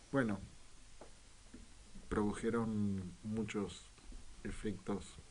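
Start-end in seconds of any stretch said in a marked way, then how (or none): none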